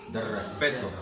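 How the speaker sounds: married woman's rebuff. Retold or imitated. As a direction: shush him quietly, playing the proper married woman. Completa la frase de la mujer. (mujer casada rechazando, mucho más bajo y suave)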